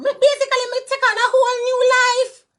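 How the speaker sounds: high, loud burst of excitement; a gleeful squeal about a fresh start; sudden high-pitched joy; excited. Real, not acted.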